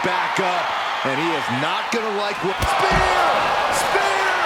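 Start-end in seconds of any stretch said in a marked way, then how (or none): 2.6-3.3 big high shout of the move name; shouted call. Acted. Commentator shouts the move as it hits. 3.7-4.4 big high shout of the move name; shouted call. Acted. Commentator shouts the move as it hits.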